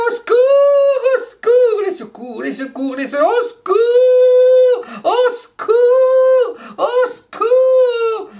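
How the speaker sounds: high, loud, drawn-out cries of au secours; panicked maid crying for help. Acted. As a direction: wail for help like the frantic maid. Maid calling for help, acted.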